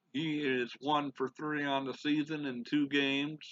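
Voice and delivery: play-by-play announcer. Acted. Gamer calling the final at-bat, broadcaster play-by-play voice. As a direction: sell the last at-bat like a broadcaster.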